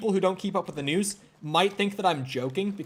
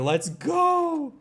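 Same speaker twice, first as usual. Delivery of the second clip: very high, long whooped cheer; excited cheer; 13 semitones higher than usual. Real, not acted. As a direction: whoop it, holding the last word high. Celebrates finding the shotgun.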